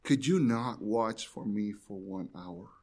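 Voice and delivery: soft, hushed reading of the verse; quiet scripture reading. Acted. Preacher reading verse forty to the congregation, hushed and low. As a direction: let the words sink low and quiet. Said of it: "and it says".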